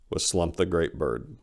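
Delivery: ordinary delivery, nothing marked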